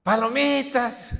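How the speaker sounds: high, drawn-out hawker's call; sing-out sales shout on a held high note; street vendor cry. Retold or imitated. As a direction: imitate a vendor calling through the neighbourhood. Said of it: "empieza a gritar todo el vecindario".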